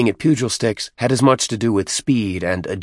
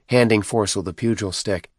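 plain reading voice

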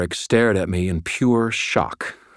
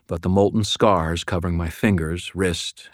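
ordinary delivery, nothing marked

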